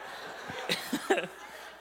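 airy unvoiced chuckle; breathy laugh. Real, not acted. Preacher chuckling at the class reaction. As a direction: let out a soft breathy laugh.

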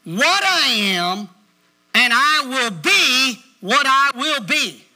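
slow, weighty preacher's declaration; punched words with big pauses between phrases; emphatic preaching. Real, not acted. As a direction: drive each phrase home to the congregation.

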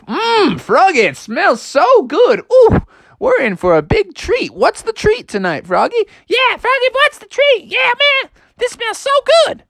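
very high, squeaky family voices; sniffing dinner, can't wait to eat; eager family. Acted. Family calling to Froggy about dinner, high and eager.

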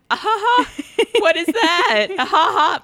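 high forced polite laughter; awkward fake laugh. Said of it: "And I was like".

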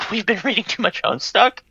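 normal talking voice

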